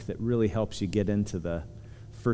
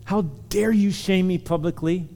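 imagined angry father; 7 semitones higher than usual. Pretend. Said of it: "He could have said".

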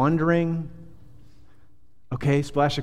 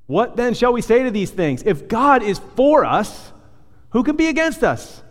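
soaring proclamation; 9 semitones higher, 4 dB louder than usual. Acted. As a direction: lift the verse up like a triumphant cry. Preacher proclaims key verse.

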